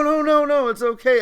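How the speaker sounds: high, loud, hurried mock reassurance; imitated urgent reassurance. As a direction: play onlookers urgently talking someone down. Imitating everybody reassuring him frantically.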